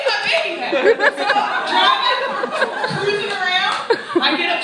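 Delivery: loud, laughing hype; loud excited shouting, laughing; hyped up. Retold or imitated. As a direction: pump yourself up on the drive.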